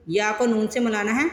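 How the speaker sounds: ordinary talk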